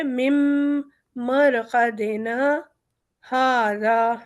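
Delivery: slow chant, a short stop after مَرْقَدِنَا before هَذَا; slow recitation with pause. Shown as a demonstration. Teacher demonstrating the stop before هَذَا, slow recitation. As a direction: draw out مَرْقَدِنَا, stop briefly, then هَذَا. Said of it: "سکتہ کریں گے اور پھر ہاذا پڑھیں گے".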